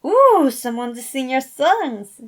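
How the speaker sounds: loud, high, drawn-out delighted ooh; excited exclaim. Acted. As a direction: cheer at the happy news. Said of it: "she exclaimed".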